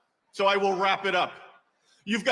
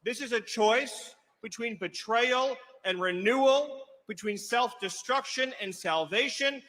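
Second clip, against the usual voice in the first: solemn rally speech. Acted. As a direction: weigh each phrase before the crowd.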